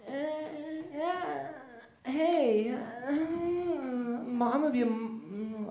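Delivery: put-on high, slow girly voice; deliberately bad impression of a girl. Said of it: "really bad impression of being a girl".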